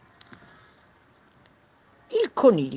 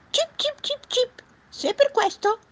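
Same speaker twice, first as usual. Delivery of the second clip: tiny, extremely high chirping voice; shrieking bird; 6 dB louder than usual. Acted. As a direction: chirp and squeal like a little bird. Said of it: "strillò l'uccello". (vocina stridula da uccellino)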